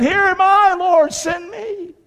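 high, loud then very slow offering; eager volunteer. Retold or imitated. Preacher voicing Isaiah answering the Lord, high eager cry. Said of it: "They said".

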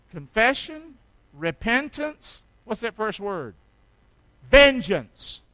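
loud, slow, building sermon; preaching at full force. Acted. Pastor preaching to the church about being shoved, loud and slow. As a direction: drive it home from the pulpit.

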